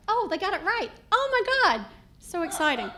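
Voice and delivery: mock thrilled. Customer whose name was got right, mock thrilled. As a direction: gush as if it were a miracle.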